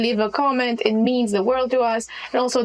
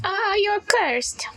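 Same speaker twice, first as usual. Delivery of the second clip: gloating hag; 7 semitones higher, slower than usual. Acted.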